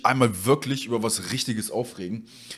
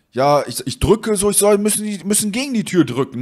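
higher, louder retold back-and-forth; retold insistent explaining; 8 semitones higher, 4 dB louder than usual. Customer tells courier at the door to push, insistent. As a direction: explain insistently over an intercom, raised pitch.

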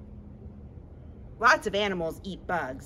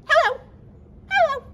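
quick, clipped, high and loud hellos; fast hello; 8 dB louder, faster than usual. Shown as a demonstration. Librarian leads the kids' fast hello, quick bursts. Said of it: "Let's say hello as fast as we can".

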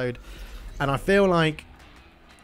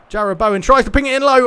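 much higher, louder rising commentary shout; excited shot call; 10 semitones higher, 11 dB louder than usual. Acted.

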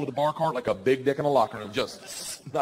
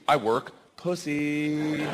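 slow, deadpan; much slower than usual. Shown as a demonstration. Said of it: "what the sentence says".

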